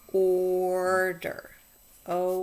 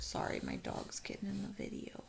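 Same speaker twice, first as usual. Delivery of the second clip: whispered apology off to the side; whispered aside; 13 dB quieter than usual. Real, not acted. Teacher whispering an apology to the class about her dog. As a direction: drop to a whisper to excuse the dog.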